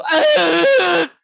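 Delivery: put-on high, loud child's screech; imitated tantrum scream at full pitch. Kid who doesn't get what they want, screaming. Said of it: "screaming as loud as they can".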